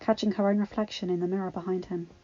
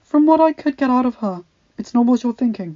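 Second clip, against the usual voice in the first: steady reassuring knight; 4 semitones higher, 10 dB louder than usual. Acted. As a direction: reassure your prince.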